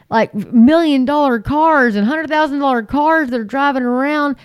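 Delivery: normal talking voice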